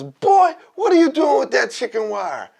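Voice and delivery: high, loud scolding holler; imitated yelling old man. Old man challenging boy with chicken wire, mock yell. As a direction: holler it like a cranky neighbor. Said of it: "He's yelling at him".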